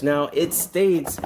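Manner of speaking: normal talking voice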